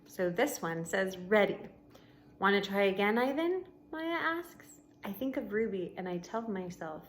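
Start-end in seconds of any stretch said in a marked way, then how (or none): 1.2-7.0 steady read-aloud voice for the class; story narration. A narrator's reading. Teacher reading a novel to her class, storybook narration.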